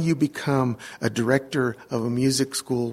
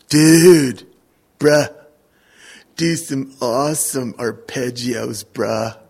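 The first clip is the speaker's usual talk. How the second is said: imitating young fan; 6 dB louder, slower than usual. Mimics young fan after concert.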